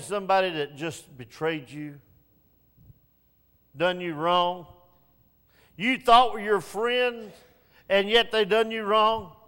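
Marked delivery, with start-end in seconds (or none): 5.8-9.4 1 rising preaching. Real, not acted. Preaching builds.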